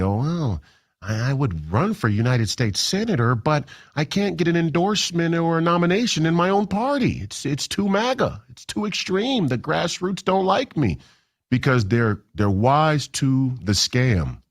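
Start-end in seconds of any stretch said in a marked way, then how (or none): 0.0-11.0 higher, whiny mock of a moderate politician; mocking Tim Pawlenty. Host mocking Tim Pawlenty's excuse for not running, high whiny imitation. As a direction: whine it like a sore moderate. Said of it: "Tim Pawlenty".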